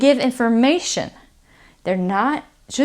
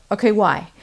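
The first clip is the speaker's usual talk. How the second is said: usual speaking voice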